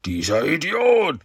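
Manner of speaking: langsam gedehnter, hoher Wutausbruch; tobend. A narrator's reading. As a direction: den Idioten hoch und zornig verfluchen. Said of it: "tobte der Shredder". (tobender Schurke, gedehnt und verstellt)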